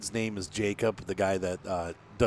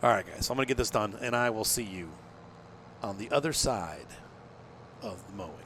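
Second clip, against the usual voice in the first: usual speaking voice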